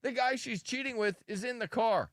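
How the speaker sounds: high, emphatic explaining; insistent and high-pitched. Real, not acted. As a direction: spell out the outrage, pitched well up. Exclaiming over a played clip.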